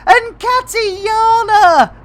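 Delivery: long, loud, high call of the name; slow joyful hail; drawn-out shout. Real, not acted. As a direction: belt it out slowly across the room. Calling out a viewer's name.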